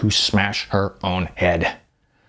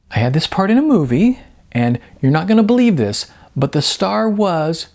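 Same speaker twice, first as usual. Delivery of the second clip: excited bragging; 8 semitones higher than usual. A narrator's reading.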